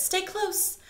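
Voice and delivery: high, hushed mother call; mother bird calling. Acted. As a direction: call your chicks close, softly. Mother merganser calls chicks.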